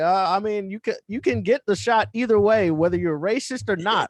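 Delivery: loud, high-pitched exasperated reply; exasperated explaining. Retold or imitated. Retold exasperated reply to patient.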